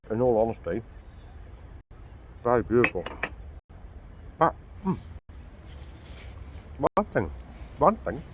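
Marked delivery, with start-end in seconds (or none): 2.4-8.2 talking through a mouthful; words round a bite of food; mouth full. Real, not acted. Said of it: "I'm sorry about me eating with my mouth full again".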